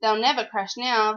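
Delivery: ordinary delivery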